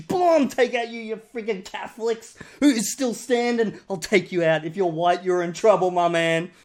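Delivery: hammed-up shooter yelling boom at each target; mock shooting spree. Picking off language fans one by one, loud mock shooting.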